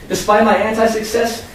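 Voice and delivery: plain reading voice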